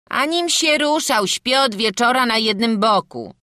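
high, loud whiny protest; a boy denying it loudly; protesting lad. A narrator's reading. Frącek protesting to his father, high and loud.

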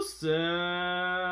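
normal talking voice

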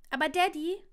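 put-on high, bright little-boy call; eager child. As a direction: pipe up like a small child. Small child asks father.

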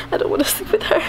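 high, breathy imitation of a whimpering child; imitated child's half-crying protest; mock whiny refusal. Retelling child's refusal on waking.

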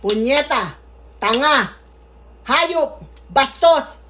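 ordinary talk

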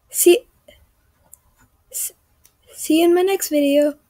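mock vlogger goodbye; YouTuber sign-off. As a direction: sign off the video with energy. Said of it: "the classical Apari".

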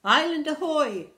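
high, very loud hail; lookout's shout. Acted. Pirate shouting from the crow's nest, hailing shout. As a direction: holler it from high up. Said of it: "shouted Pirate Violet".

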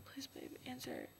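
hushed plea to the phone; barely breathed prayer; desperate whisper. Real, not acted. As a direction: whisper it, begging her to pick up. Calling girlfriend, begging.

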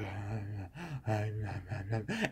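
faint, barely voiced ah ah; mock weak moans. Mocking the burned wife's faint moans. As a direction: moan faintly, almost nothing.

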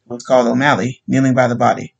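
ordinary delivery, nothing marked

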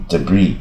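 "debris" with no final s; silent S. Shown as a demonstration. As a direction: say "debris" as duh-BREE. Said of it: "这S也不发音".

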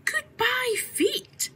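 very high, sing-song goodbye to her feet; high farewell. A narrator's reading. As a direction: call it up high and far. Alice bids her feet farewell.

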